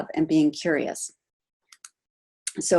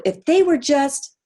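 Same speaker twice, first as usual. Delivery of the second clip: mock blaming others; 8 semitones higher, 4 dB louder, slower than usual. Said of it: "blaming someone else".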